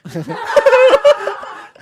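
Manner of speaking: 甲高い大笑い; 思わず吹き出す. Real, not acted. 相方の冗談に爆笑. (高い声の大笑い)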